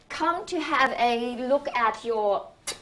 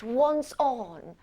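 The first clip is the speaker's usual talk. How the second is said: normal talking voice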